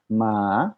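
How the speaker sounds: second tone "ma", pitch climbing; rising tone on ma. Shown as a demonstration. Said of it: "Dia dibacanya nadanya juga naik".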